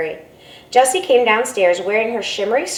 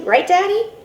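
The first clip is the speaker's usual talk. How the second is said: appealing to dad; 10 semitones higher, 3 dB louder than usual. Acted. Sister appeals to father.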